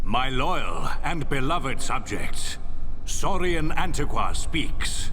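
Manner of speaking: loud, ringing royal address; formal proclamation. Acted. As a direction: proclaim it to the crowd below. Emperor addresses his subjects.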